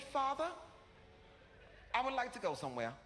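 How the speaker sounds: high, formal bougie son; Hassan. Retold or imitated. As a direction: make a polite request in mock upper-crust speech. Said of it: "He said".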